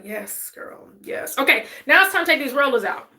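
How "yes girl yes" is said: sultry voice. Pretend. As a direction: purr it out, sultry. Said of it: "even my voice changes".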